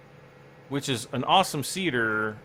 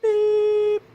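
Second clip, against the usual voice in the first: high, loud squeaky "beep" sound effect; beep noise; 8 dB louder than usual. Acted. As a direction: blurt out a shrill high beep like a machine. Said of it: "I always have to make that noise".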